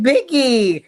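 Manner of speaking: high, loud drawn-out holler; hammed-up imitation of someone calling; mock calling out. Acting out Summer calling to Biggie.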